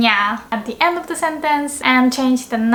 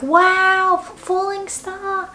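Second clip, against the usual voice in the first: high, breathless wonder; amazed; 8 semitones higher, slower than usual. Shown as a demonstration. Teacher acts out seeing a falling star, high amazed. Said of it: "It's like".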